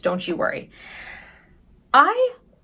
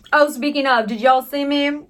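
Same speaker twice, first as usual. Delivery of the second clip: normal talking voice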